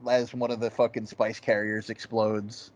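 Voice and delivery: ordinary talk, nothing marked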